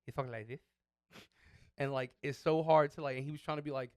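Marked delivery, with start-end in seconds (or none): 0.1-0.6 imitating a Castilian-style lisp; mock lisp on "this"; Spanish lisp. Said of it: "one of those I'm from Spain lisps".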